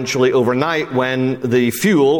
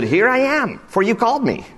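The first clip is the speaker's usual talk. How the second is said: put-on young boy voice; 10 semitones higher than usual. Boy Samuel answering Eli.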